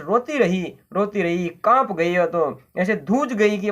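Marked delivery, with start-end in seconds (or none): none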